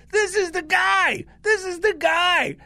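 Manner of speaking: high, insistent imitation of the sister; the sister's excited voice, retold; excited recognition.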